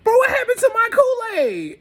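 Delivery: high, loud outraged outburst, retold; mock high-pitched yell; flipping out. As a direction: act out someone flipping over a ruined drink. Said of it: "She flips".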